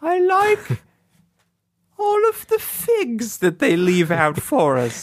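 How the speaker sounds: slow, high mock English lady; subdued English lady. One club lady to her fellow ladies, slow high English accent. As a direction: put on a drawn-out, high-pitched English ladylike accent. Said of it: "subdued English lady".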